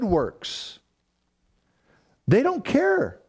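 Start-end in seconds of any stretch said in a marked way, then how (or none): 2.3-3.2 they don't care, jumped way up in pitch; high preaching lift. Acted. Preacher contrasting the righteous, lifting his voice high. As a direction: lift it high with carefree fire.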